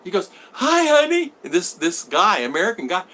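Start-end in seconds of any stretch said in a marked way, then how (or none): 0.5-1.3 high, eager hello; excited greeting. Retold or imitated. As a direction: call out to her, overjoyed. Suitor greeting his girl.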